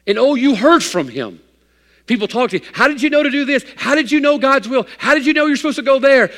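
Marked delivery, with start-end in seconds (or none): 2.7-6.3 eager questioning voices. Retold or imitated. People asking the eager believer.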